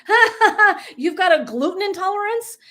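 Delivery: high, loud, teasing laugh-talk; mock jeering laughter. Imagining herself mocking someone's gluten intolerance, jeering laughter. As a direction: jeer it with an exaggerated laugh.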